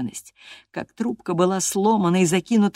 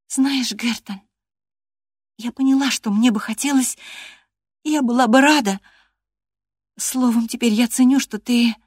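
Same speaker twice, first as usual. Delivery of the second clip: Catherine's voice; 7 semitones higher, 4 dB louder than usual. Acted. Catherine pleading with Hareton.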